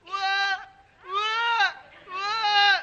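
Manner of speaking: high mock newborn wail; baby crying imitation. Husband faking his baby's cry down the phone, high wail. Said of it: "هتسمع؟".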